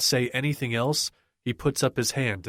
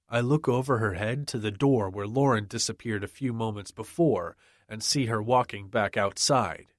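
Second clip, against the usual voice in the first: usual speaking voice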